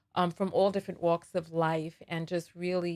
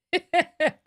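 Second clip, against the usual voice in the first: burst of laughter; 8 semitones higher, 8 dB louder than usual. Real, not acted. Bursts out laughing mid-story.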